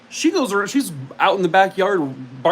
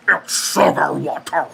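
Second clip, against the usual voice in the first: a slow put-on voice; movie quote impression; 4 dB louder than usual. Repeating the movie quote for viewers to guess: slow put-on voice. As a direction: deliver the line like the film character, slowly. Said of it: "this one comes with another impression".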